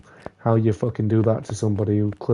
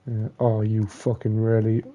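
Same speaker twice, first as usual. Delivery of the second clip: normal talking voice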